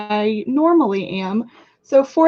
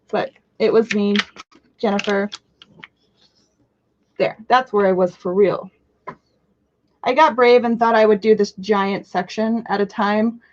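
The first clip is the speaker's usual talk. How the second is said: ordinary talk, nothing marked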